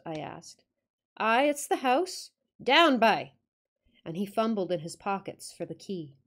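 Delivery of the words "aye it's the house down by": loud, higher Yorkshire shout at the dog; Yorkshire command to dog. Acted. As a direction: bark the order at the dog in Yorkshire. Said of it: "attempt the accent".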